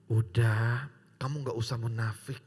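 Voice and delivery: low breathy whisper of a tempting aunt; seductive whisper. Retold or imitated. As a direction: lure him home in a hushed whisper. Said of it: "dia ngomong gini".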